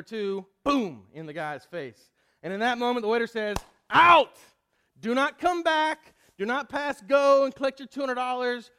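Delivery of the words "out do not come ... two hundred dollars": furious shout. Acted. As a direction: throw him out, loud and fed up. Waiter finally kicks customer out.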